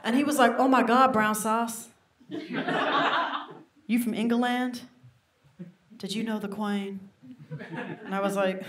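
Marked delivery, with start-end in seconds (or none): 0.6-7.0 lightly voiced American accent. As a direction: play an awed American tourist. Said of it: "I love American names".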